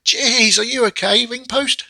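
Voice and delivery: high, loud mock concern; mock-worried check on the ring post, high and loud. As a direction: fuss over the ring post in a squeak. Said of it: "I was like".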